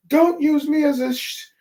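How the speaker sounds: Usopp protesting. Retold or imitated. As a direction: squeal it like a cornered coward. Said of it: "and Usopp says".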